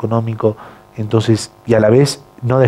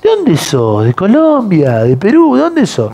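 curious Argentines; 7 semitones higher, slower than usual. Retold or imitated. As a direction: play the people asking where he is from. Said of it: "Y me decían".

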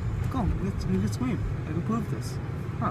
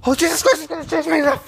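high, loud, crying-out panic; panicked crying shout; 9 semitones higher, 5 dB louder than usual. Real, not acted. Son running from his furious dad, crying out in panic. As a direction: shout it half in tears, terrified.

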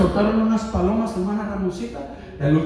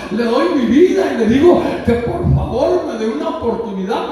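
high, raised-voice exhortation; fervent preaching; 7 semitones higher, 5 dB louder than usual. Acted. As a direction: urge them with full pulpit force. Preaches at full force. (exhortación ferviente a viva voz)

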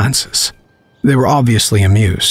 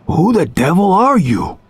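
blunt, higher-pitched challenge; blunt demand; 8 semitones higher than usual. A narrator's reading. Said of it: "said Harper bluntly".

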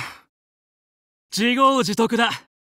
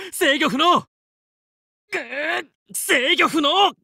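high, slow shout as control fails; alarmed shout; 6 semitones higher, slower than usual. Acted. Pilot losing control of his ship, high slow shout. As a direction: yell it out while spinning.

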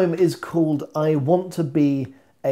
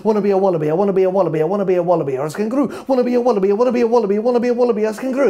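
faster, louder, higher chant; speeding up; 6 semitones higher, 5 dB louder, much faster than usual. Acted. Poem chant speeding and rising.

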